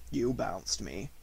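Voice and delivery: low, slow accusation; gruff donkey grumble; gruffly. A narrator's reading. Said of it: "said Eeyore gruffly".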